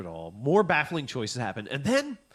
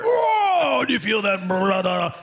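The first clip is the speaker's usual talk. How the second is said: much higher, loud wrestler bellow; Hulk Hogan; 5 semitones higher, 6 dB louder, slower than usual. Retold or imitated. Wrestler voicing the mom, loud hammed-up promo yell. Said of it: "they could have had Hulk Hogan".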